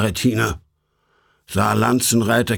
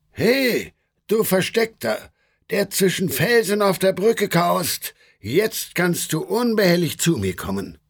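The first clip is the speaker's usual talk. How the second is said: calling out; 8 semitones higher than usual. A narrator's reading. Guide calls to hidden companion.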